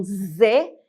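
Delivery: buzzing, voiced "Z"; "Z" with a voiced Portuguese sound. Shown as a demonstration. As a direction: say "Z" as a buzzed "zê". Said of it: "Ao contrário do português".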